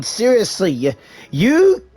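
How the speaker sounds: ordinary talk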